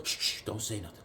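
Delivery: low, hushed shushing; hushing whisper. Retold or imitated. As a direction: hush it so nobody hears. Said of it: "my mom goes".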